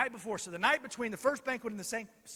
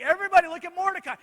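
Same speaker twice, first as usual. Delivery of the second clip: loud, high, rushed proclamation; unhappy announcement; 8 semitones higher, 11 dB louder, much faster than usual. Acted. Haman forced to parade Mordecai through the city, grudging. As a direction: shout it out quickly, like a grudging herald. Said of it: "Haman is not happy".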